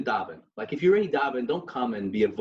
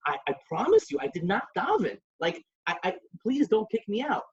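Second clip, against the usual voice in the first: a mild imitated plea, much higher; pleading; 10 semitones higher than usual. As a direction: beg the gabbai not to throw him out. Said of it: "he's like".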